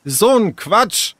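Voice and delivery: high, drawn-out angry dismissal. A narrator's reading. Sarah brushing off Tessa's accusation, angry. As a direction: scoff hotly, stretching it out. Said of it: "wütend warf Sarah die Haare nach hinten".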